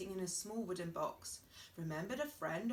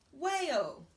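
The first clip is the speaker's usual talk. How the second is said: louder, higher punchline; big reveal; 7 semitones higher, 8 dB louder than usual. Acted. Cat proudly revealing his guess, louder and higher. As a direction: boom the word out.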